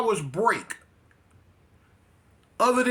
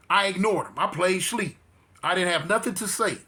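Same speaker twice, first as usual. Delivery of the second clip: usual speaking voice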